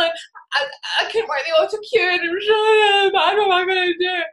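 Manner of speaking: high, sobbing mock wail; hysterical crying imitated. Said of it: "you know, you're crying".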